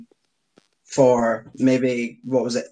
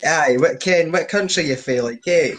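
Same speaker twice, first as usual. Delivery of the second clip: laughing mock East Coast Scottish accent, a touch louder; heavy East Coast Scottish accent; 5 semitones higher, 5 dB louder than usual. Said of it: "Heavy East Coast Scottish accents".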